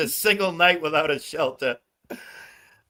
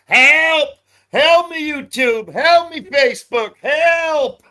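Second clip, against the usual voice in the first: high, loud mock plea for help; shrill hammed-up help calls, much higher and louder; mock cry for help; 11 semitones higher, 6 dB louder, slower than usual. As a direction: ham up a panicked victim yelling help. Mocking desperate people calling out.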